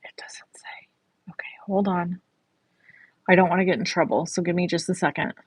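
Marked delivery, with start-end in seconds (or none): 0.0-2.2 whispering. Real, not acted. Checking product release rules.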